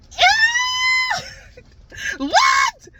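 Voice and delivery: very high, loud squeal; mock disbelief. Vlogger reacting to claims racism doesn't exist, squealed disbelief. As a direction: squeal it in exaggerated shock.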